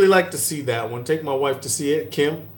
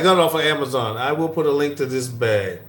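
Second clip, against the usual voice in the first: usual speaking voice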